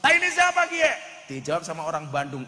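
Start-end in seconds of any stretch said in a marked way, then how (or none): 0.0-1.2 high, loud shouted Ngapak question. Retold or imitated. Tegal man yells at the Bandung local, shouting. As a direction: yell it out like an outraged Tegal man. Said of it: "Akhirnya begitu masuk".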